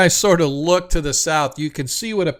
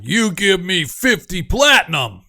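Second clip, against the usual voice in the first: greedy giant; 4 semitones higher, 4 dB louder than usual. Acted. Giant greedily raises his demand.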